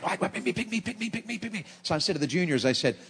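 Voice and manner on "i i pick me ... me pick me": put-on high, eager child pleading to be picked; eager kid begging. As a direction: pipe up like a kid bouncing in his seat.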